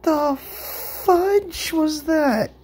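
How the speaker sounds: drawn-out high shocked cry; slow, stunned, very high disbelief. Real, not acted. Sudden surprise at the game.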